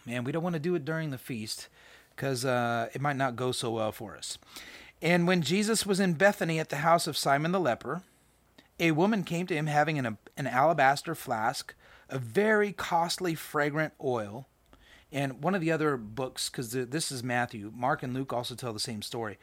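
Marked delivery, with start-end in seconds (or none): 5.0-14.5 scripture reading. Acted. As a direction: read the passage out with weight. Reads scripture aloud.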